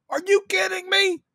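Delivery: high-pitched incredulous cry; high squeal of disbelief; incredulous exclamation. Retold or imitated. Shouting his reaction at the movie.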